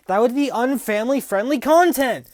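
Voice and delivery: high, loud, exasperated; mock outraged shout. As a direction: yell it in mock panic. Mock outrage about demonetization.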